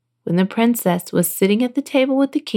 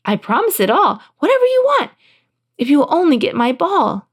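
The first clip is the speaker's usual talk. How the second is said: high, gushing storybook princess; eager princess; 6 semitones higher than usual. A narrator's reading. Princess promises anything to get her ball, eager high voice. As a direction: promise eagerly, pitch leaping up. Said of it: "she answered".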